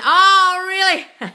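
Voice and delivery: very high, loud Oh, really then a laugh; delighted surprise. Real, not acted. Vlogger reads a funny comment, high and loud surprise. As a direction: shriek it with amused surprise. Reacting to a bee van comment.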